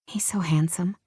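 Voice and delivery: ordinary delivery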